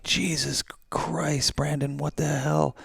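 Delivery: high, slow, stunned outcry; a drawn-out, high-pitched shocked gasp of a question; imitating a stunned friend, high and slow. Friends shocked at salvia fall.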